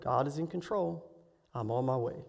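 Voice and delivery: low, soft, steady reassurance; quietly composed, no cry in the voice; calm. Retold or imitated. Mother five hours away trusting God, calm. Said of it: "I did not hear a cry in her voice".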